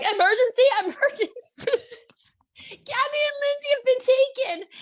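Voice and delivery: high, squeaky panicked bulletin with a laugh; mock alarm. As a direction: imitate a panicked walkie-talkie alert. Acts out alarm over walkie.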